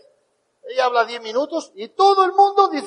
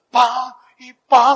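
golpes secos al volante hechos con la voz; golpes imitados; 3 semitones higher, 7 dB louder, much slower than usual. Retold or imitated. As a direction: imita dos golpetazos fuertes y espaciados. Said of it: "empieza a golpear el volante". (onomatopeya de golpes)

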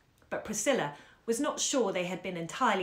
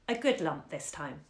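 ordinary delivery

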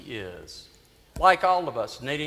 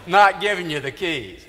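laughing through the words, louder; laughing refusal; 3 semitones higher, 7 dB louder than usual. Retold or imitated. Priest laughs while refusing Willie the keys, laughing. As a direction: chuckle it out as you say no.

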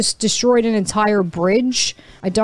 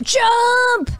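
high, drawn-out jump call; shout-squeal the launch; high gleeful cry; excited command; 11 semitones higher than usual. Real, not acted. Launching after run up.

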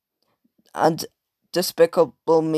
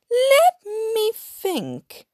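much higher, slow sing-song; imitating Miss Hattie; slower than usual. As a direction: stretch it out very high and musing. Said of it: "Miss Hattie was saying this".

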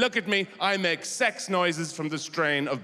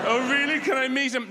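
higher, wide-eyed eager questions; eager naive caveman; 6 semitones higher, 3 dB louder than usual. Acted. Gullible caveman asking to meet God, eager and higher. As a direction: ask brightly like a gullible believer. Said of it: "somebody came around the corner and said".

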